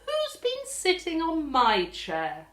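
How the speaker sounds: a high mother bear, put out; Mummy Bear. Acted. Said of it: "I said".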